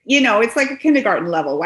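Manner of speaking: ordinary talk, nothing marked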